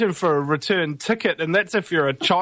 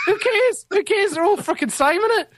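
high, scoffing mock voice; sneering high who-cares voice; mocking dismissal. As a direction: toss it off as if nobody could care less.

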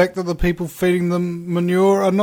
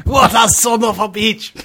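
laughing Scottish exclamation; 6 semitones higher than usual. Acted.

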